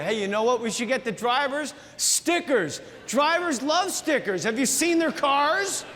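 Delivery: high-pitched, over-excited idea pitch; mock enthusiasm of a boardroom brainstormer; eager executive. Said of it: "when nascar executives came up with that idea".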